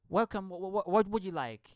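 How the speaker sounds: lightly imitated Indian English accent. Imitating an Indian greeting a customer, light Indian accent. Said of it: "it's very much like that".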